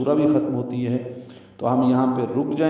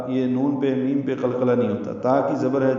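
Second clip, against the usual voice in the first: ordinary talk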